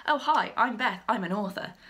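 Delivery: usual speaking voice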